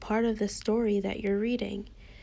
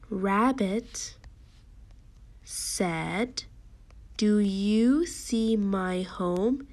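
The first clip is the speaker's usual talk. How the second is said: very slow, deliberate storybook reading; teacher reading aloud word by word; slow read-along; much slower than usual. Shown as a demonstration. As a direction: stretch each word so children can follow.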